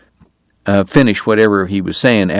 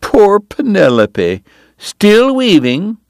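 mocking; 12 semitones higher, 3 dB louder, much slower than usual. Kate mocks the cousin.

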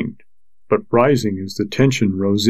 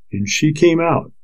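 ordinary delivery, nothing marked

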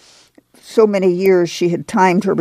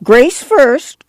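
high, insistent clamor; Grandma insisting; 8 semitones higher than usual. A narrator's reading. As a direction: demand it like a stubborn granny. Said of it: "Grandma clamored".

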